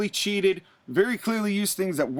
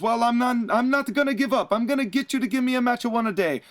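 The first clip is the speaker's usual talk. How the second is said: high, stubborn hammed-up Italian voice; defiant mock Italian; 6 semitones higher, faster than usual. Santina refusing to give up to Arn, in a high mock-Italian accent. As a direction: push back defiantly, pitched up. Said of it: "And Santina goes".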